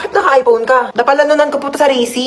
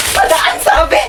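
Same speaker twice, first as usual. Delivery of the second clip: impatient call; 5 semitones higher, slower than usual. Acted. Asks again, losing patience.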